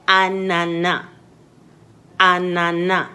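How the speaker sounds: anana with open French A; clear French A. Shown as a demonstration. Teacher models French A for students. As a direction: model anana with broad A sounds. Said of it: "words that have A in them".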